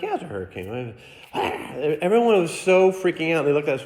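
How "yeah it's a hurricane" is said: deadpan. Retold or imitated. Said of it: "I'm like".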